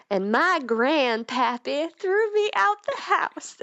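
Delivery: put-on Southern accent. Role-playing for her partner, sob story in a hammed-up Southern accent. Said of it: "putting on this Southern American accent".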